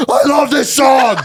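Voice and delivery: loud, high, drawn-out fan yell; ecstatic shout. Retold or imitated. Bleeding guy runs back into the pit, ecstatic shout. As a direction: imitate a fan screaming with joy.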